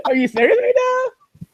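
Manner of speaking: high, laughing disbelief; incredulous. Retold or imitated. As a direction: ask it like you can't believe your ears. Repeats the disbelieving retort.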